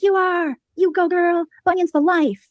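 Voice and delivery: high, gushing fan voices; imitating adoring fans; mock fan cheer. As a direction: cheer like devoted groupies. Voicing fans cheering her on.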